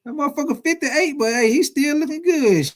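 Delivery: high, airy surprised reaction; amazed exclaim. Retold or imitated. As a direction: retell being impressed by him. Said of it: "I said".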